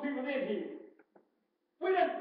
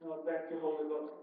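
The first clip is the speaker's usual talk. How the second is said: quiet thanks; 10 semitones lower, 6 dB quieter than usual. Real, not acted. Prays after the whoop, spent.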